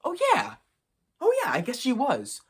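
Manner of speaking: high, sing-song careless mockery; mocking the brother's shrugged-off reply. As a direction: toss it off like it never mattered. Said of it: "this motherfucker says".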